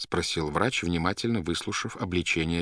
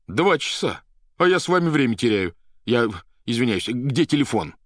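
loud, high, agitated burst; urgent exclamation; 6 semitones higher, 7 dB louder than usual. A narrator's reading. Patient realises he is wasting time, urgent exclamation. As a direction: demand the phone, voice raised. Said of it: "воскликнул Иван и поднялся с дивана".

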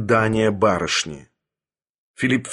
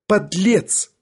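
high, indignant exclamation; tearful outrage; 8 semitones higher than usual. A narrator's reading. Young lady confronts Sharikov, tearful outrage. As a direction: fling the insult in a high voice. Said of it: "выговорила барышня, сверкая заплаканными".

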